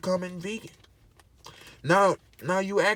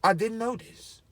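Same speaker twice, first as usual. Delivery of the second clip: mock amazement; 4 semitones higher, 9 dB louder, slower than usual. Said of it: "And you gonna be like".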